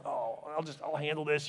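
low, hushed impatient aside; frustrated inner voice. Retold or imitated. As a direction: think it aloud under your voice, a touch frustrated. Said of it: "I was frustrated, going".

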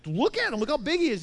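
high, incredulous exclamation; imitated worked-up soldiers; alarmed protest.

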